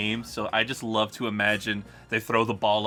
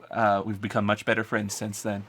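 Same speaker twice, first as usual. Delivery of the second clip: ordinary delivery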